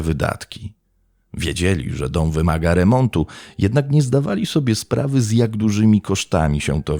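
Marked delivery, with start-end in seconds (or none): none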